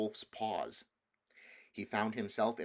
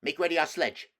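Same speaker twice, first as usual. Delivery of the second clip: loud, high commanding witch; ordered; 8 semitones higher, 10 dB louder than usual. Acted. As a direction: snap the order to the dwarf. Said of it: "ordered the witch".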